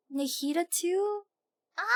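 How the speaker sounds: ordinary talk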